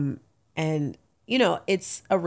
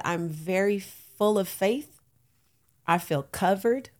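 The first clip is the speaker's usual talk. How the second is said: ordinary talk, nothing marked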